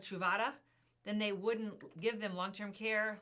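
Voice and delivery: ordinary talk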